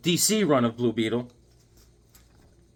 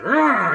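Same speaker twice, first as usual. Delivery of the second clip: loud, high roaring "arr"; imitated roar while hauling on a chain; monster growl; 6 semitones higher, 6 dB louder than usual. Frankenstein yoking up the chained werewolf, loud imitated growl. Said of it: "He's like".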